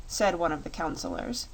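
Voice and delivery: ordinary delivery, nothing marked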